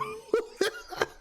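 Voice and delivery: high breathless laugh; real helpless chuckle. Real, not acted. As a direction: burst out laughing. Reacting to PS5 memes.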